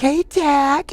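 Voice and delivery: high, sugary greeting to a dog; high sweet girl voice calling the dog; sweet vet tech. Retold or imitated. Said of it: "She's like".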